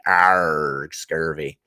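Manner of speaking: low, drawn-out pirate "arrgh"; hammed-up old sea-dog voice; mock pirate. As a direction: growl it like a pretend pirate. Mock pirate growl at mention of scurvy.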